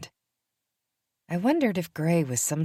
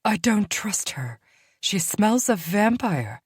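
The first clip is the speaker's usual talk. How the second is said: higher, slow and disdainful; a hint of a she-wolf sneering at a vampire's scent; distrustful she-wolf; 6 semitones higher, slower than usual. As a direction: sneer about the newcomer. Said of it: "the first female hissed".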